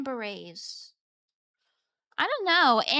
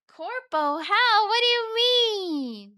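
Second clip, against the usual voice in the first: "Corpo?" higher and louder in shock; loud disbelief; 7 semitones higher, 7 dB louder than usual. Real, not acted. Reacting to chat's job claim, loud disbelief. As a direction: blurt it out amazed.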